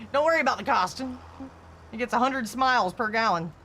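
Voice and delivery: high, loud bragging dodge; mock car owner. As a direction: brush the question off like a proud owner. Imitating BMW owner.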